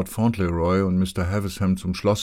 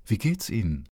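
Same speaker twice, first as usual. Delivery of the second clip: bright voice; 3 semitones higher than usual. Acted. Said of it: "sagte er mit heller Stimme".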